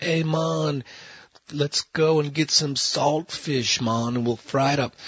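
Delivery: playful imitation of a Jamaican accent. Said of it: "That's my best Jamaican accent".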